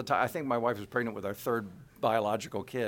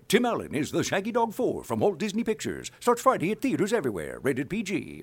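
big, higher trailer announcer read; movie trailer voice; 8 semitones higher, 4 dB louder than usual. Shown as a demonstration. Voice actor demos his trailer work for the interviewer, booming announcer. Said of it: "on a day-to-day basis is movie trailers".

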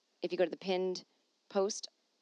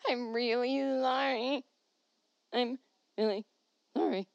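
mock whiny apology; 4 semitones higher than usual. Shown as a demonstration. Apologizing to listeners for her accent, whining. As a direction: drag out the apology.

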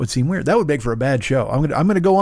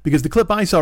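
usual speaking voice

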